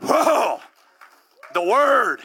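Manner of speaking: ordinary talk, nothing marked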